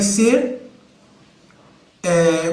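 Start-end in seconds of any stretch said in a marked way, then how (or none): none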